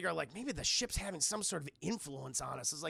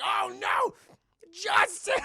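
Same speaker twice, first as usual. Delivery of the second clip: high, loud mock wail; hammed-up anguished yell; horrified cry; 13 semitones higher, 10 dB louder, slower than usual. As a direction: shout the name in dismay. Crew horrified, acted.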